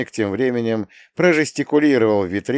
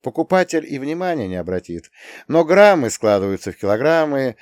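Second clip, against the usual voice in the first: plain reading voice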